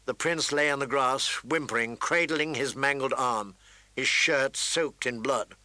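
ordinary talk, nothing marked

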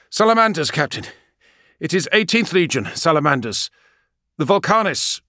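high, loud pilot voice; a crisp pilot calling in from the cockpit; gunship pilot. Acted. Pilot identifies the ship.

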